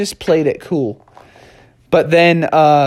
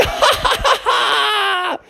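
high, gleeful cackle; bright triumphant laughter. Acted. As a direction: laugh out in victory. Techno Bear celebrating success.

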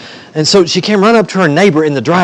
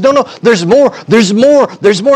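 excited insistence; 6 semitones higher than usual. Acted. Excited woman insists there is more.

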